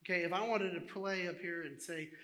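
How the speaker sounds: ordinary talk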